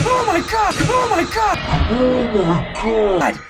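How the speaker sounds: high panicked yelp; scared shriek. Real, not acted. Jump scare in horror game.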